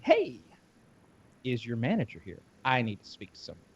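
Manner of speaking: hushed. Retold or imitated. Asking for manager, hushed.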